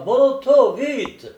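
high, loud urgent cry; shouting. A narrator's reading. Master at his bedroom door ordering his car, shouting. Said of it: "ouvrit rapidement alors la porte de la chambre et cria".

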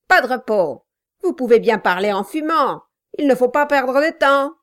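voix plus aiguë et forte, ordres hargneux; un nain tyrannique qui menace son commis; nain brutal qui commande. A narrator's reading. Quilp presse Brass. (Quilp, le nain brutal, aboie ses ordres)

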